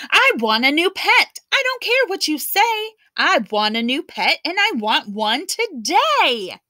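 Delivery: high, loud spoiled-brat demands; bossy child. Acted. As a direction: snap out orders like a spoiled girl. Louise demanding a new pet.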